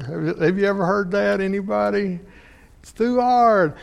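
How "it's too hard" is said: put-on high whining child voice, much higher; mock child whining it's too hard; whiny child. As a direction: whine like a kid who won't try.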